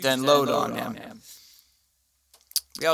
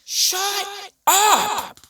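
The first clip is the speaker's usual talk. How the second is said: high mock yell. Yelling back at yappy dogs.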